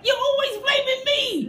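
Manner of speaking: high whining protest; high-pitched mock whine; whiny kid complaint. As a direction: play the wronged child. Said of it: "he'd be like".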